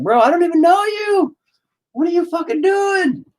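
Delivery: very high, hammed-up squeal of protest; panicked cloud voice. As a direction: squeal like a wronged cartoon victim. Plays the attacked cloud protesting.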